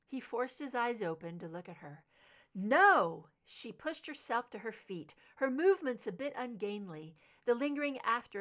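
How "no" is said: loud anguished no; shouted no. Acted. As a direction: cry out in denial. Mother rejects news of death.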